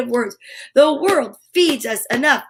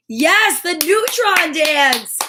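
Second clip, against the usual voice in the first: ordinary talk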